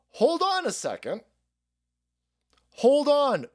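sudden high "hold on"; startled high voice. Retold or imitated. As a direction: jump up high in surprise. Acting Hunter on phone.

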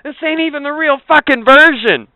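a hint of a betrayed kid; outraged kid. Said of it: "be like".

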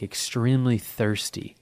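ordinary delivery

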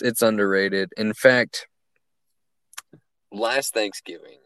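ordinary talk, nothing marked

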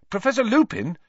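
put-on high, loud boyish cutting-in; loud interruption. A narrator's reading. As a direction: cut in loudly, pitched right up. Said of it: "Harry interrupted loudly".

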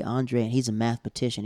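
normal talking voice

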